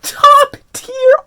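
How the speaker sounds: very high, loud fan squeal; high-pitched fangirl outburst; gushing hype. Real, not acted. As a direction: squeal it out, stretching the praise. Excited gushing over the artwork.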